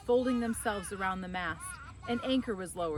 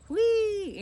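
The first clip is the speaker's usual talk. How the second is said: a high, loud whistle of wonder; 14 semitones higher, 9 dB louder than usual. A narrator's reading. Angelo whistling at the beautiful red-sailed boat, loud and high. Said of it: "Angelo gave a low whistle".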